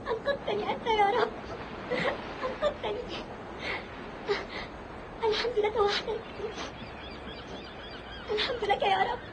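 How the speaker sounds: tearful thanks. Acted. Rescued castaway thanks God.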